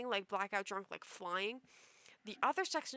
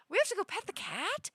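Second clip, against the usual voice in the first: much higher, disbelieving question; incredulous echo; 10 semitones higher, 6 dB louder than usual. Retold or imitated. Dreamer echoing drunk friends' plan, incredulous high question. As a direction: repeat it back in disbelief.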